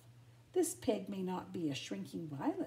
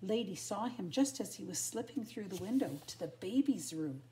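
usual speaking voice

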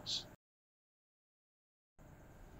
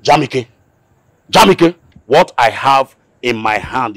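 angry shout; 5 semitones higher, 8 dB louder than usual. Acted. Uncle threatens with gun.

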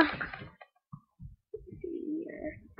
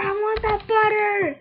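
excited; 7 semitones higher, 5 dB louder than usual. Real, not acted. Repeats, then calmer take.

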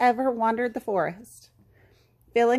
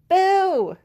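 loud, high, drawn-out boo; big storybook scare shout; hollering; 9 semitones higher, 6 dB louder than usual. A narrator's reading. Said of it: "they hollered together".

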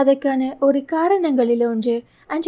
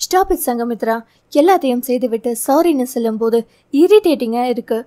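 usual speaking voice

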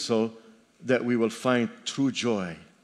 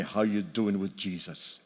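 imitating his pastor; 3 semitones lower than usual. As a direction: mimic an annoying old pastor nagging a young man. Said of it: "that's how he talks".

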